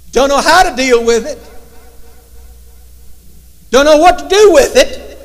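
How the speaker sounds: loud, high, driving preacher's cry; full-force preaching. Acted. Voicing his own fearful prayer.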